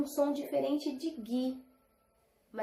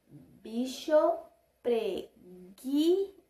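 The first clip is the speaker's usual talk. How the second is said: slow; much slower than usual. Shown as a demonstration. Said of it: "prestarem atenção no som".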